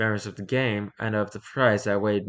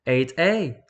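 ordinary delivery

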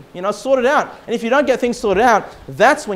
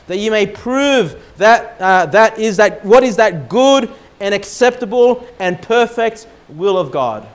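slow, louder solemn reading of the verse; scripture reading; 2 semitones higher, 5 dB louder than usual. Acted. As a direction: read it like Scripture from the pulpit. Reads scripture aloud.

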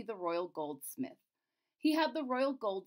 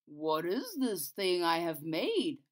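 low, slow puzzled craftsman; a baffled old goldsmith; 4 semitones lower, slower than usual. Acted. Goldsmith voiced.

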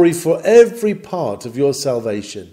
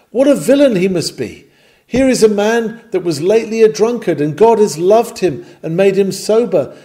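raised, higher and louder ringing denunciation; indignant rebuke; 6 semitones higher, 5 dB louder than usual. Acted. Preacher rebuking a proud sinner, with loud indignation. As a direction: call out the thief from the pulpit. Preacher denouncing a glory thief.

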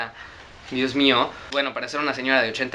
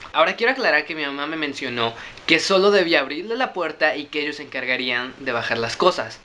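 plain reading voice

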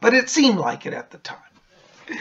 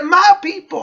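pleading bag voice; 12 semitones higher, 8 dB louder than usual. Acted. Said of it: "He said".

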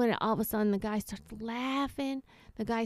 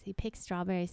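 ordinary talk

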